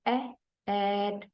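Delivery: ए stretched very slowly before कैट; drawn-out vowel. Shown as a demonstration. Teacher stretching the vowel ए in कैट for students. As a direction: hold ए long, then say कैट.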